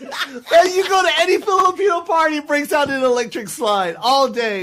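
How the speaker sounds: high-pitched excited shout; excited outburst. Real, not acted. Comedian agreeing with host about the electric slide, excited outburst.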